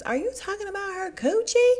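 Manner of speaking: scandalised disbelief; shocked. Retold or imitated. Wendy asking Karen at dinner, high and shocked.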